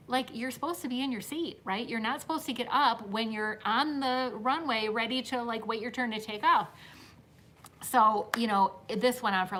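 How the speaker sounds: ordinary delivery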